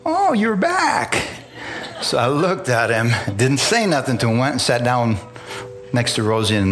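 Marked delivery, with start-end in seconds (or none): 0.0-1.2 high, slow, drawn-out exclamation; mock delighted greeting. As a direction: imitate someone cooing at a returning sleeper. Evangelist greets him as he comes to.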